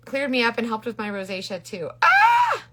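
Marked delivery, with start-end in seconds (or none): 2.0-2.6 short, loud high shriek; high-pitched burst of a scream; excited scream. Real, not acted. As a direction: let out a sudden piercing yelp. Sudden playful outburst at comments.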